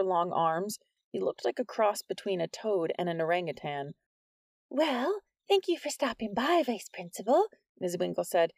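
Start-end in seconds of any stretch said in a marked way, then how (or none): 4.7-7.5 high, polite teacher voice; nervous teacher. A narrator's reading. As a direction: brush him off with high politeness.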